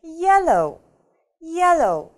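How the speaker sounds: yellow with YEL punched high and loud; stress on first syllable. Shown as a demonstration. Teacher showing learners first-syllable stress in yellow. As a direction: hit the start of yellow, let the end fall. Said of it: "Ударение падает на первый слог".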